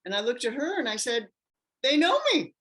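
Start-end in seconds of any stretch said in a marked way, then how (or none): 1.8-2.6 high, loud they know me; stunned outburst at a pamphlet; amazed exclaim. Retold or imitated. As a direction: blurt it out, stunned and amused. Reading pamphlet of her symptoms.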